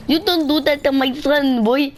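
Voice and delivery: high-pitched imitation of a Mexican accent; mock Mexican accent. Parents scolding the boy over their son, mock Mexican accent. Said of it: "they weren't speaking good English".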